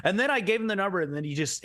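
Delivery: normal talking voice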